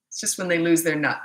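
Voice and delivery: ordinary talk, nothing marked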